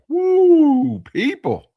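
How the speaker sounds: high, loud whoop of delight; excited whoop. Real, not acted. Thrilled by the coffee, whooping to viewers. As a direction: let out a big happy whoop.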